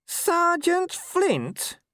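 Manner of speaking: very high, slow squeak of utter disbelief; little voice. A narrator's reading. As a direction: squeak it out slowly, stunned. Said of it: "said Nobby in a little voice".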